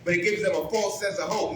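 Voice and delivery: normal talking voice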